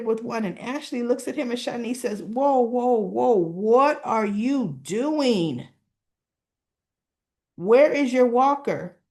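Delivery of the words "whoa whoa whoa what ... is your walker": slow, loud, stretched-out reprimand; acted scolding. Nurse stopping Derek with his hockey stick, scolding. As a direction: halt him, dragging out each word.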